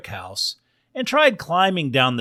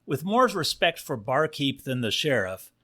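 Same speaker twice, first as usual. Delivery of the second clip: ordinary delivery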